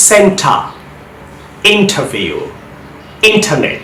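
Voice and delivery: British crisp T in সেন্টার, ইন্টারভিউ, ইন্টারনেট. Shown as a demonstration. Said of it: "British অ্যাকসেন্টে কিন্তু টি এর উচ্চারণটা".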